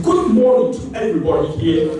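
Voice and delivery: put-on British accent greeting the room; mock British accent. Contestant stepping on stage to greet everybody, mock British accent. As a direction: fake a formal British accent. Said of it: "with a British accent".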